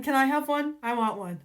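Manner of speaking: ordinary delivery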